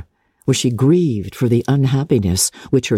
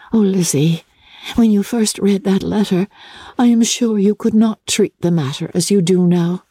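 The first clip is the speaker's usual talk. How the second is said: put-on a lightly raised, gentle young woman's voice; lightly voiced gentle sister; Jane's voice; 6 semitones higher than usual. Jane speaks.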